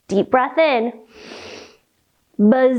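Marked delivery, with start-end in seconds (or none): 2.4-2.8 one very long, steady buzz; long bee buzz. Shown as a demonstration. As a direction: let all the air out on a zz. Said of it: "let all the air out with a buzzing sound".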